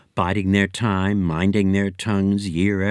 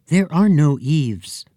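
higher, louder little brother; boy's voice; 6 semitones higher, 4 dB louder, slower than usual. Acted. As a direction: brother correcting his sister. Brother correcting sister.

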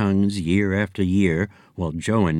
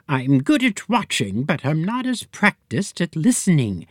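higher, lightly voiced Miss Hunch; prim fortune teller; 7 semitones higher than usual. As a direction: delicate seer confessing a weakness.

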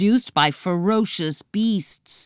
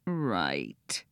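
low, soft and dejected; sad; 11 semitones lower, 11 dB quieter than usual. A narrator's reading. Pig conceding the boy's point, low and sad. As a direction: let it drop, sadly. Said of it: "the pig said sadly".

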